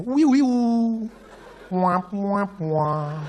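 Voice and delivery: high, slow whistling effects; sound effects. Retold or imitated. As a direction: mimic gunfire with the mouth. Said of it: "made great sound effects".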